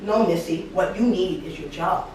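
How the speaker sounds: low, soft threat; stern boss. Retold or imitated. Boss shuts down reluctant employee, quiet threat. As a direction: put her in her place, low and quiet. Boss's threat in retold scene.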